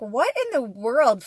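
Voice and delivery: slow, loud what in the world; baffled disbelief at the toy faces; stunned outcry; shocked. Retold or imitated.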